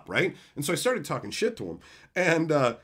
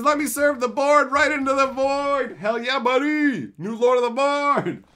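loud, higher hype-man voice; hyped cheering; 9 semitones higher, 6 dB louder than usual. Retold or imitated. Imitating Callaway cheering his comment reply. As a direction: cheer like a buddy hyping you.

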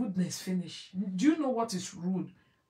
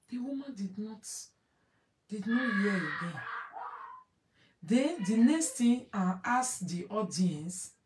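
ordinary talk

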